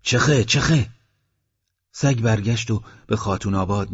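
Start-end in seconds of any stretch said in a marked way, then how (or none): 0.0-0.9 loud, high shout to shoo the dog away. Acted. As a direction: yell to drive the dog off. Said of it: "داد زد".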